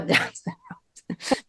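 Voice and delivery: slow, breathless whisper with a laugh; whispered aside. Real, not acted. Comic slipping in an edgy aside, whispered. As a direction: whisper it like a guilty secret.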